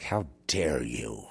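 low, slow, contemptuous mock accusation; a lightly voiced hateful townsperson; scornful townsfolk. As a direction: sneer it out as the scornful locals. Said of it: "they're looking at you with scorn".

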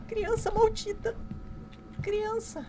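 high, slow squeal of outrage after a scare; rattled cursing. Real, not acted. Player scolding the child that scared her, rattled squeal. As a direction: curse it in a high, frightened voice.

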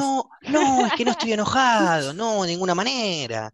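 mild hammed-up "no estoy enojado"; mock protest. Shown as a demonstration. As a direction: insist you're not angry, a bit worked up. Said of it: "Así te respondo".

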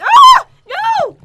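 very high, very loud shriek; panicked scream. Real, not acted. Player ambushed by opponent, panicked scream. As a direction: scream and cry out in alarm. Attacked suddenly in game.

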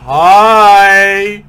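high loud startled cry; startled shriek. Real, not acted. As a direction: yelp at the jumpscare. Jumpscare shriek.

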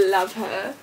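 normal talking voice

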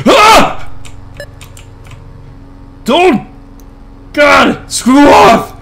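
high panicked shriek cracking; real scared scream; voice cracking in fright. Real, not acted. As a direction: yell in terror as the voice cracks. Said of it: "Voice crack!".

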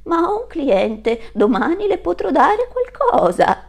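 mother goat placating the landlord; timid mother goat. Acted. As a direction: beg for time nervously. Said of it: "disse mamma Capra".

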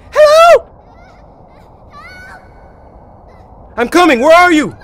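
loud, high-pitched calling; little-girl-high yell. Pretend. Player calling to someone lost in the woods, high yell. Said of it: "I sound like a little girl".

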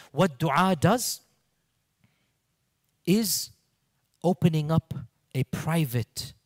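ordinary talk

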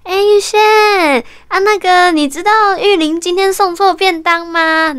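imitating the boss phoning to ask; boss on the phone. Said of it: "那老板就说".

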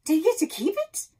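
loud, high eager question; excited. Acted. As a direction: blurt it out eagerly.

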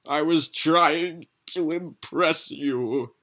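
slow, high, loud last words; dying wizard confessing to Piz, slow and high. Acted. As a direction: gasp out final words slowly.

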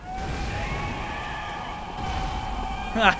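high, soft drawn-out laugh; gleeful laugh. Real, not acted. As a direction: laugh at an enraged foe. Laughs at enraged boss.

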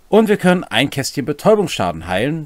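usual speaking voice

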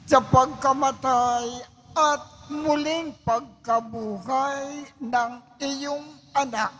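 normal talking voice

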